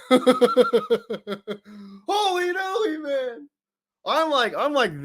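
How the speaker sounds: squeaky high laugh into holy moly; high giggling exclamation. Real, not acted. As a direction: breaks up, voice jumping high. Laughing at a chat name.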